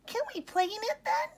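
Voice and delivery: put-on high, fast, pleading young voice; eager little lamb. A narrator's reading. Ollie asking his mom to play in the snow, high and eager. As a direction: rush it out like an excited little one.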